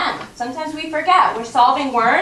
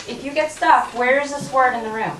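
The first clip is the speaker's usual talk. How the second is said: ordinary delivery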